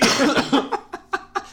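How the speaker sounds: high, loud laugh; loud high giggle. Real, not acted. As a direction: burst into high cackling. Laughing at own joke.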